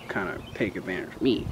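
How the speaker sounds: ordinary talk, nothing marked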